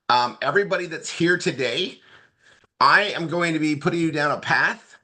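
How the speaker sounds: normal talking voice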